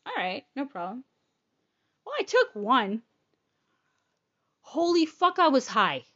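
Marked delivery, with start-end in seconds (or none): none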